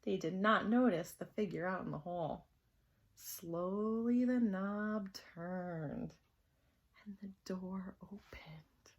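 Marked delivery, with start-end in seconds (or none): 3.1-8.9 very slow, fading to a whisper; hushed, creeping cliffhanger; suspenseful narration. A narrator's reading. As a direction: stretch it out, then whisper the door opening. Suspense as door slowly opens.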